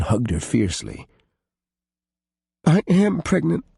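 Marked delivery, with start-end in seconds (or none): 2.7-3.6 higher, lightly tearful; understated sobbing confession; a touch of a sob; mild sob. A narrator's reading. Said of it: "she said in a sob".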